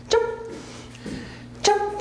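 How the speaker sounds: high-pitched jump cue; high command. Real, not acted.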